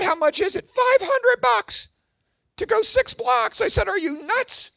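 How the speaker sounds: very high, incredulous dad voice; hammed-up sticker shock; outraged disbelief. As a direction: squeak with exasperated disbelief. Acted argument over limo price.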